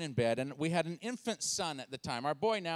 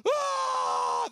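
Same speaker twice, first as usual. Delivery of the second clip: long, loud high shriek; piercing sustained scream; imitated screaming; 6 dB louder than usual. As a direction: wail like a panicked wife and baby. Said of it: "Just my wife and my son".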